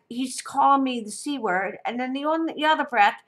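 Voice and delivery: ordinary talk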